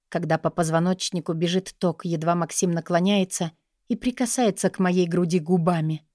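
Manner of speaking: usual speaking voice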